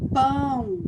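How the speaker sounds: pão with a loud, strongly nasal ão; nasal demo word. Shown as a demonstration. Teacher giving pupils a nasal example word, loud and nasal. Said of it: "Por exemplo".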